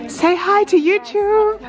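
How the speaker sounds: high, bright-eyed call to the group; excited prompt. Real, not acted. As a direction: get them to wave at the camera.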